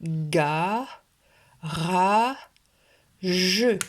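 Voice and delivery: GA, RA, JE as three separate drawn-out beats; each of "ga, ra, je" held long and pushed out; split into syllables. Shown as a demonstration. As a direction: dictate "ga, ra, je" syllable by syllable, pausing between. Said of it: "le couper en trois syllabes".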